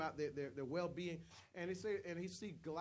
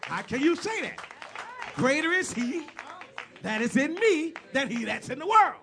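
loud, raised, ringing declaration; full-force preaching; 10 semitones higher, 17 dB louder than usual. Acted. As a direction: proclaim scripture at the top of your voice.

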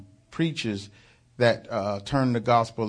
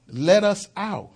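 mild demon scream; 7 semitones higher than usual. Retold or imitated. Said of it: "And then they screamed".

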